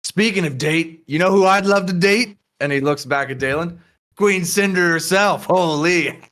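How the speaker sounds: sleazy mock apology, then brag loudly; leering drunk. Drunk patron leers about queen.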